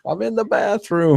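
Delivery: high, squawking mother call from off-stage; screeching mom voice. Retold or imitated. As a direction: yell it like a nagging sitcom mom. Imitating the sitcom mother.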